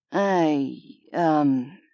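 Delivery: low, hesitant trailing off. A narrator's reading. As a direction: let it trail off uncertainly. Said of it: "His voice trailed off".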